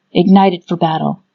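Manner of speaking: usual speaking voice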